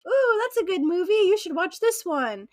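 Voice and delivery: high, bright-eyed gushing stranger voice; chatty stranger. Retold or imitated. Utah shopper chatting up a stranger at Walmart, high and gushing. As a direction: gush over a movie tip like a new best friend.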